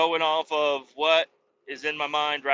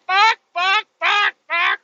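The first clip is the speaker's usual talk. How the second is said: very high, loud frantic squeal; panicked whimper; 7 dB louder than usual. Retold or imitated. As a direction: freak out in a corner over the blunder. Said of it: "Poland just goes and cries in the corner".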